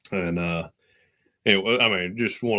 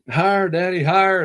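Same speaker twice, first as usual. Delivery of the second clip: put-on higher-pitched little girl begging, louder; child begging higher; 10 semitones higher, 6 dB louder than usual. Daughter on the swing begging her dad, eager child voice. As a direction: chirp it like an excited four-year-old. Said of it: "four-year-old's like".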